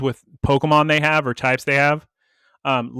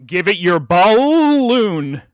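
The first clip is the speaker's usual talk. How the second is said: BALLOON drawn out slowly and loud, wavering at the end; indecisive emphasis; 5 semitones higher, 6 dB louder, much slower than usual. Shown as a demonstration. Host reading the odd episode title, indecisive drawn-out emphasis. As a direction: stretch BALLOON, unsure where the emphasis lands. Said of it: "I like the indecision that at the end".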